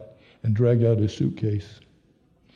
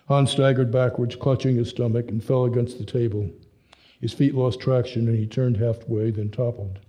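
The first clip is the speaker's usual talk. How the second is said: ordinary delivery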